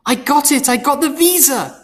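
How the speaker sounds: high, loud burst of joy; young man thrilled on the phone to his mother; excited. A narrator's reading. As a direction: blurt out the good news. Telling mother the news.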